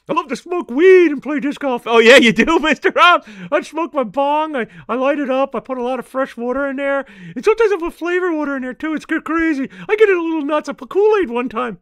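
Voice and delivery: a cheeky stoner puppet griping about the dog; Mister Rob puppet voice. Acted.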